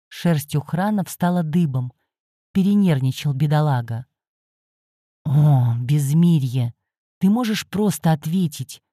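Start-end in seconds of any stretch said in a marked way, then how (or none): none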